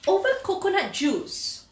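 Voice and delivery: usual speaking voice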